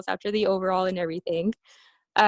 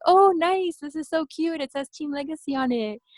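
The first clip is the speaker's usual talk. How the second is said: excited squeal; 6 semitones higher than usual. Retold or imitated. Excited reaction to coach's jacket.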